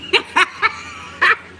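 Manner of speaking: a high airy laugh, no voice; breathy laugh. Real, not acted. Mocking spooky talk, high airy laugh.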